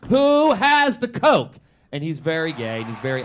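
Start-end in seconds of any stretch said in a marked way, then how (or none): 0.1-1.6 high, loud, drawn-out scream for coke; wild piano-bar holler. Retold or imitated. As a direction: scream it out slowly to the whole bar. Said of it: "he's now screaming".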